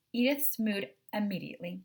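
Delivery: ordinary delivery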